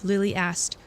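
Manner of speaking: usual speaking voice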